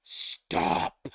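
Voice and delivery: commanding monster word; mighty command. Acted. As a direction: bark one huge word. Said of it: "lets out a mighty word".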